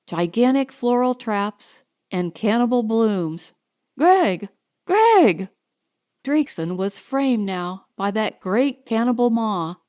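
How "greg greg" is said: loud, much higher frantic shouts of a name; rising panic cries; desperate calling out. A narrator's reading. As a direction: scream his name as the plant closes in. Trapped man calls out for help.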